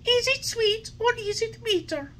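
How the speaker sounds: put-on high, whiny little boy voice; whining puppet. A narrator's reading. Puppet whining to the fairy about medicine. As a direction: whine like a spoilt child. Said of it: "asked in a whining voice".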